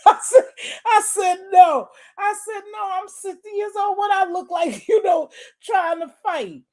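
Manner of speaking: high loud laughing no; laughing protest. Real, not acted. Sixty-year-old laughing off the idea she'd fight. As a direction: laugh out the denial.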